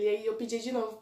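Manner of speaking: normal talking voice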